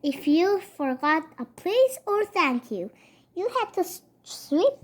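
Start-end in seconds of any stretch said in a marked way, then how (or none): none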